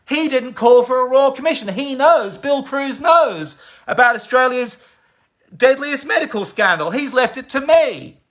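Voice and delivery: high-pitched, indignant outburst; impassioned denunciation. Real, not acted. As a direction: denounce him with voice pitched right up. Denouncing Bill's silence on scandal.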